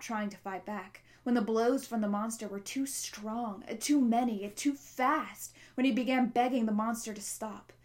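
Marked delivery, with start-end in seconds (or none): none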